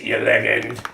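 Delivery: catchphrase "legend" with a hard g; dirty driver impression. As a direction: imitate the dirty driver's "you leg-end". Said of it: "that always says".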